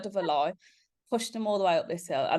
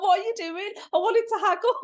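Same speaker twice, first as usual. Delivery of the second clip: very high, exasperated squeak; dismayed fiancé; 5 dB louder than usual. Retold or imitated. Fiancée mimics Dwayne dismayed that his mum paid, very high. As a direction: whine it in comic disbelief, pitched way up.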